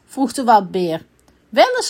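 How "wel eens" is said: high, loud princess retort; stubborn high-pitched shout; calling out. A narrator's reading. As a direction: call back insistently. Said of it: "riep de prinses".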